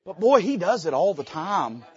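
ordinary talk, nothing marked

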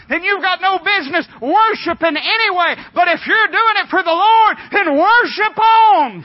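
high, loud, driving exhortation; full-force preaching. Real, not acted. Preacher urging the congregation to worship for the Lord, shouted exhortation. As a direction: preach it at a shout, building to the last words.

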